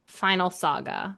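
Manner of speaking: usual speaking voice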